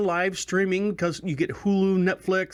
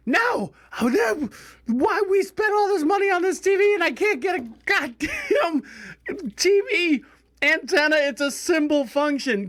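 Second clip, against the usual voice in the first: exasperated rant; 10 semitones higher than usual. Retold or imitated. Wife ranting about the antenna.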